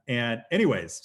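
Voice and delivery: ordinary talk, nothing marked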